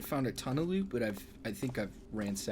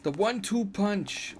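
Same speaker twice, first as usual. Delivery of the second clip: put-on Irish accent; 10 semitones higher, 5 dB louder than usual. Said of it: "I was going for Irish".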